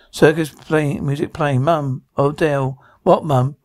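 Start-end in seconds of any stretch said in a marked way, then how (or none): none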